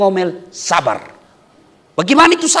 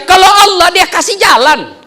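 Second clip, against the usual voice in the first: preaching peak; 8 semitones higher, 6 dB louder than usual. Acted. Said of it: "preaching peak".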